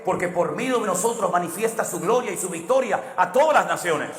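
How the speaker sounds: rising, swelling preacher's cry; impassioned sermon delivery; preaching at full force. Acted. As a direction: paint the victory parade with mounting fervor. Preacher presses the point.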